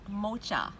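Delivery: mocha said as "MOH-chuh"; slow mocha with "ch" instead of "k"; dad's mispronunciation. Retold or imitated. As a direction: mimic dad saying mocha with a "ch" as in chair. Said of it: "When he's ordering coffee".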